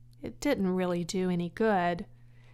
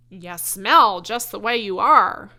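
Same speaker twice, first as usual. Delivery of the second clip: much louder, jeering lamb voice; rude lamb; 12 dB louder than usual. Acted. Lamb mocking Wilbur's smell, jeering. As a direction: jeer at the pig.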